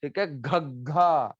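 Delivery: घग्घा slow, exploding from the chest; bursting gh sound. Shown as a demonstration. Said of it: "बम फट रहा है घग्घा". (घग्घा को बम फटने जैसा धीरे बोलो)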